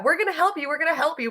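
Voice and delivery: high sing-song mock promise; mocking politicians. Mocking politicians' empty promises, high sing-song.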